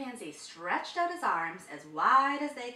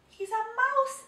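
high, loud astonished cry; amazed; 14 semitones higher, 9 dB louder than usual. A narrator's reading. Elephant discovering Big Bro, high astonished cry. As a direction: blurt it out amazed.

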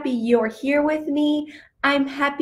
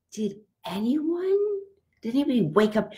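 normal talking voice

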